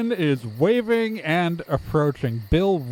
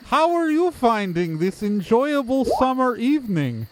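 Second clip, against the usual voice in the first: higher-pitched, stilted friendly townsperson; overly normal townsperson; 8 semitones higher than usual. Acted. Game master as townsperson greeting the newcomers, stiltedly cheerful. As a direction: greet like a creature faking being normal.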